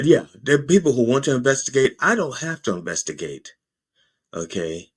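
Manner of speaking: usual speaking voice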